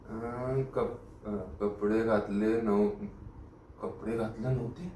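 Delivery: slow, low, soft repetition; slow to writing speed, end on a doubtful question; writing, then puzzled. Acted. Inspector noting what clothes Raghu wore, slowly growing puzzled.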